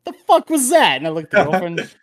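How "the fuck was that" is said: much higher, louder stunned outburst; baffled disbelief. Retold or imitated. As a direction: sound completely thrown. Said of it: "I'm like".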